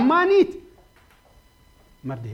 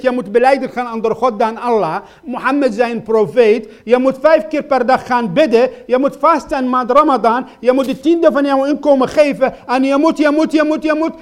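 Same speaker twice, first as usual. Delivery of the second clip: louder, insistent list of rules; stern old man; 9 semitones higher, 14 dB louder than usual. Retold or imitated. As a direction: lay down the law like a demanding elder. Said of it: "En hij zei tegen mij uiteindelijk".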